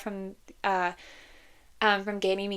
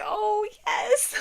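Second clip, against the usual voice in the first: high, thrilled little squeal; delighted high-pitched fangirl yes; gushing delight; 12 semitones higher than usual. Retold or imitated. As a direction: gush like a fan whose ship just sailed. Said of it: "I was like".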